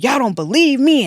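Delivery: puffing up, imitating a friend getting mad. Roommate imitating her friend insisting nobody believes her, mock angry. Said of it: "but she's getting mad".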